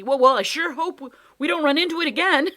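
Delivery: fervent. Acted. Boy hoping never to meet the monster, fervent. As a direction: wish it with all your heart. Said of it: "Tom said fervently".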